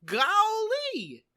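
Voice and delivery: high, aw-shucks naive bumpkin, drawn-up golly; Gomer Pyle. Acted. Cohost mimicking naive Marine recruit, high aw-shucks voice. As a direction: innocent savant surprised at himself, pitched high. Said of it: "he's just like".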